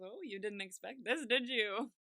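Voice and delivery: lightly gloating imitation; mock taunting. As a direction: needle them a little, building to the last word. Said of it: "he's taunting him a, a little bit".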